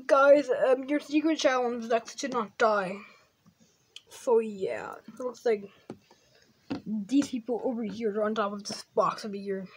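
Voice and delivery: normal talking voice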